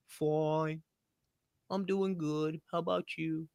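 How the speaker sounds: flat, quiet, dull small-talk exchange; bored small talk. Retold or imitated. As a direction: mumble it like a bored routine greeting. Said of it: "like everybody else".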